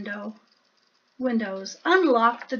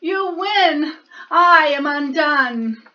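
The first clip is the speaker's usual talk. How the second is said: loud, high weepy giving up; tearful wail; 4 semitones higher, 6 dB louder than usual. Acted. Bear tearfully surrendering to the mouse. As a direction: sob it out theatrically. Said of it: "he blubbered".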